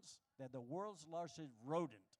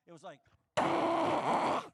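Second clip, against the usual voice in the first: toilet flush shock; 11 semitones higher, 16 dB louder, much slower than usual. Acted. Toilet flush sudden loud.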